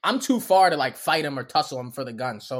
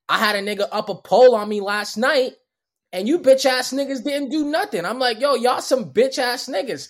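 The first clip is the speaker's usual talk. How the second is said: loud, higher, heated yelling at the cops; retold angry yell; 7 semitones higher, 5 dB louder than usual. Drunk man yelling at the police outside Dunkin', angry. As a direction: let it rip at the officers.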